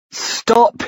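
high, sharp cry of alarm; cried out. A narrator's reading. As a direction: shout it in sudden panic. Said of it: "he cried".

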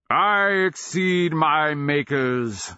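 slow, high-pitched thundering boast; thundering beast. A narrator's reading. Dragon boasting to its taunter, thundering. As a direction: thunder it slowly as a vast dragon. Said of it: "the scales-shining beast thundered".

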